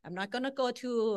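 usual speaking voice

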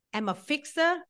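ordinary delivery

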